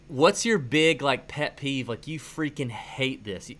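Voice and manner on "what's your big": ordinary talk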